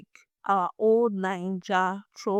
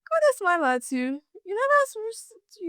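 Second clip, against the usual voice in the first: ordinary delivery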